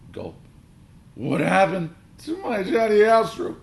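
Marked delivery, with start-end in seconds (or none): 1.1-3.6 high, loud, drawn-out screech. A narrator's reading. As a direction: screech in outrage. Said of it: "he screeched".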